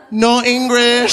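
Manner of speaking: high, slow "No English"; mock deadpan. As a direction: fake playing dumb. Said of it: "before saying".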